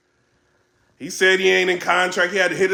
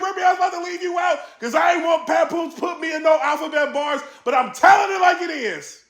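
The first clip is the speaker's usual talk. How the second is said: higher, raised mock callout; imitating Beasley; 9 semitones higher than usual. Beasley taking a shot at Remy, higher and half-shouted. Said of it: "He took a shot at Remy".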